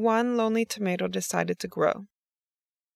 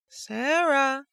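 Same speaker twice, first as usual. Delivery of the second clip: calling out; 6 semitones higher than usual. A narrator's reading. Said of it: "she called".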